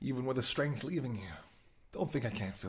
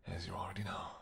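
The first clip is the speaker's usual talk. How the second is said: whisper; 4 semitones lower, 8 dB quieter than usual. Acted. Intimate seduction scene.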